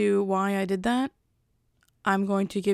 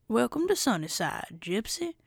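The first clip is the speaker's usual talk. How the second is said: lightly voiced Lotso greeting, pitch swooping up and down; Lotso impression; 2 semitones higher than usual. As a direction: welcome them as the toy bear, swooping tune. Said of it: "is because he said".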